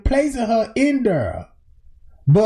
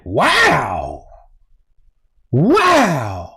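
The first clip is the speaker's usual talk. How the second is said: drawn-out wow, swooping up then sinking low; Zach's amazed reaction; 7 semitones lower than usual. Retold or imitated. Host quoting Zach on the broken freezer, mock amazement. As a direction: imitate a stunned, stretched-out wow. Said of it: "And Zach was like".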